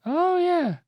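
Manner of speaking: very high delighted oh yeah; delighted surprise. Real, not acted. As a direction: squeal with delight. Delighted at correct answer.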